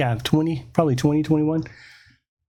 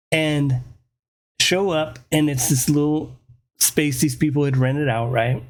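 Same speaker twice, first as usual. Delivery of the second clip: usual speaking voice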